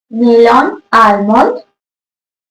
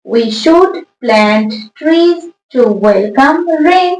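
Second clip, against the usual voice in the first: very slow dictation; much slower than usual. Shown as a demonstration. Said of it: "teacher rereads the answer".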